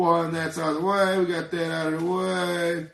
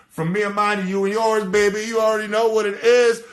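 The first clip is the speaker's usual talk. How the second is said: louder and higher, dragging out the crew name; hyped sign-off; 4 semitones higher, 7 dB louder than usual. Real, not acted. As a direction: hype up the viewers as the show ends. Shouted sign-off of the show.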